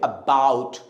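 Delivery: "about" slow, low, weight on "bout"; short "uh", then press on "bout" in "about"; stress on second syllable. Shown as a demonstration. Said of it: "मेरे साथ pronounce करेंगे". ("about" में "bought" पर ज़ोर)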